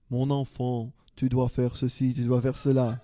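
a lightly put-on France French accent, grave and solemn; a hint of a Parisian French accent, laughing at the end; mock France French accent. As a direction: play God as a Frenchman from France, half-joking. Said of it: "J'arrive pas à faire l'accent français".

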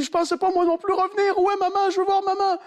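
put-on high pleading boy's voice. Dying boy asking grandmother for his mother, high pleading. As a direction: beg like a scared teenager.

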